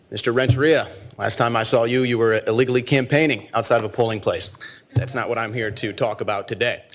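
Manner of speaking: loud, deliberate address to the council; formal public speech. Acted. Citizen at the podium addressing Mayor Adler and the council, formal and pointed. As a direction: read the charges out like an indictment.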